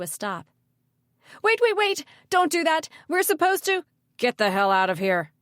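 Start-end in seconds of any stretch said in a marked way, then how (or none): 1.4-3.8 high, loud, panicked plea; frantic protest. A narrator's reading. Woman rushes in to stop the mayor, frantic. 4.2-5.3 loud, forceful dismissal at normal pitch; angry snap. A narrator's reading. Mayor orders the woman out, angry snap. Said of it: "the mayor snapped at her".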